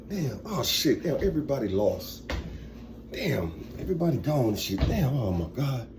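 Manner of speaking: low, quiet lament to himself; defeated, muttered swearing under the breath; dejected muttering. Acted. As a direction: grumble softly in acted-out regret. Acting out Jerry sitting down, lamenting.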